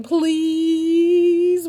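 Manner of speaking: high, drawn-out whiny please. Retold or imitated. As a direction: stretch the please out like a nagging child. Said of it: "when it turns into a twenty-seven syllable word".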